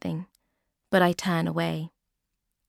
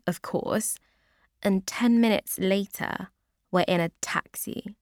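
plain reading voice

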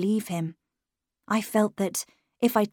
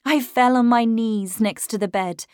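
usual speaking voice

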